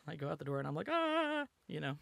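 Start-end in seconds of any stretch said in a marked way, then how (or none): 0.9-1.5 high-pitched imitated crying wail; mock wail. Daughter walking out the door, mock high wail. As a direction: let out a high, hammed-up sob. Retold crying on leaving dad.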